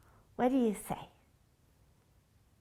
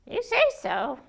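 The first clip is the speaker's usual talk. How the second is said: usual speaking voice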